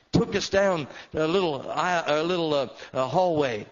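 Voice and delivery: loud, high, preaching at full force. Acted. Preacher telling the congregation his story, full-force preaching. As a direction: drive the story home to the crowd.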